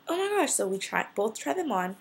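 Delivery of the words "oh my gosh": high, breathless disbelief; amazed. Retold or imitated. Teen tells shoe store story, amazed gasp. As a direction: gasp it like you can't believe your luck. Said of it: "I'm like".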